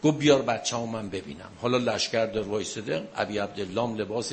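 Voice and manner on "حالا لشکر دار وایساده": ordinary delivery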